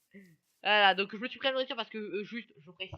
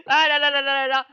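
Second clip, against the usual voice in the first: laughing; 3 semitones higher, 8 dB louder than usual. Real, not acted. Laughing after frog bit.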